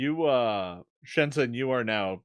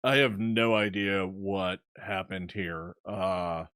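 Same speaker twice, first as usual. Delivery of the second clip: low, slow, puzzled voice; baffled Coxo; 6 semitones lower, slower than usual. Acted. Coxo arriving at the mess, low baffled voice. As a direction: drag it out low, at a loss. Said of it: "Coxo is like".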